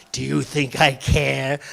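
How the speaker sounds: ordinary delivery, nothing marked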